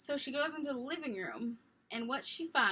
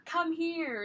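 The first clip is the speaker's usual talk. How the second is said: calling out; 7 semitones higher, 5 dB louder than usual. Retold or imitated. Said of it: "Or, or".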